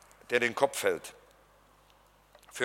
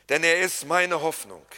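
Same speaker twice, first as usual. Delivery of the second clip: ordinary talk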